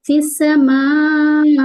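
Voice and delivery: फिस समाई with the मा stretched over four beats; slow chanted समाई, vowel held long; long madd demo. Shown as a demonstration. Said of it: "तीन से चार हरकत लंबा करेंगे".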